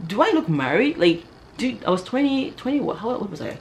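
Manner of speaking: high-pitched, incredulous questioning; indignant disbelief. Acted. Woman protesting that her crush believed she's married, indignant. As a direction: protest as if mildly insulted.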